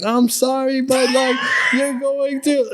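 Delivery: high, whiny imitation of sobbing relatives; mock weeping family. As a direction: squeak it out like a relative crying down the phone. Imitating crying family on phone.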